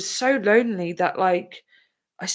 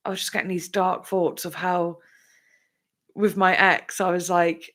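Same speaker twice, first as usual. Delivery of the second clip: ordinary delivery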